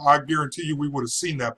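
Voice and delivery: ordinary talk, nothing marked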